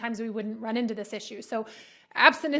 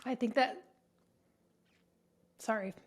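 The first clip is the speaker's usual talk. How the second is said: emotional; 5 dB quieter than usual. Real, not acted. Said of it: "I'm getting emotional".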